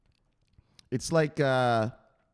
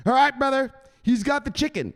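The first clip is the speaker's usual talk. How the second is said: higher, louder bro greeting; hyped shoutout; 12 semitones higher, 5 dB louder than usual. Real, not acted. Shouting out a viewer, high loud hype.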